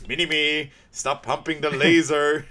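mock scolding. Imitating Doctor Evil telling off Mini-Me, mock scolding. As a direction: spell out the telling-off slowly.

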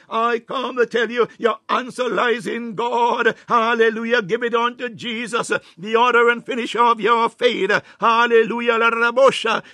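climbing sermon cry; fervent preaching. Acted. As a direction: preach it out with full force. Preaching at full force.

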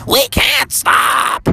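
very high, stretched-out falsetto wail; slow shrieky mother cry; Froggy's mom. Acted. Mom in labor refuses to stop, drawn-out high wail. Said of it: "And Froggy's mom said".

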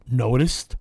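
lightly imitated machine voice; drawn-out single-word machine message; voice box. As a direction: intone it like a spirit box. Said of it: "the voice box goes".

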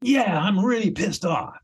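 worn-down client conceding she's angry; client admitting anger. Acted. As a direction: finally give in and own the anger.

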